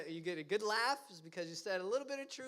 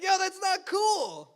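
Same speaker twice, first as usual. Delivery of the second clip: loud, slow, high protest; offended call-out; 9 semitones higher, 12 dB louder, slower than usual. Retold or imitated. Friend stung by a joke, loud slow call-out. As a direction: draw it out, calling a friend out. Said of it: "It's like".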